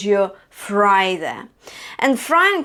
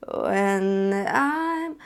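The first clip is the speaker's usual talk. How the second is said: vocal fry. Shown as a demonstration. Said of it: "that's your fry".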